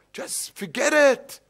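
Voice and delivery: high, drawn-out acted exasperation; exasperated dismissal. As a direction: wave the nagging away, fed up. Acted exasperated reply goes on.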